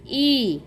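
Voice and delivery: ई as a lone "ee" vowel; phonic I sound. Shown as a demonstration. Teacher showing the sound of I, ई as "ee". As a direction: sound out ई on its own. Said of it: "आयचा उच्चार होतो".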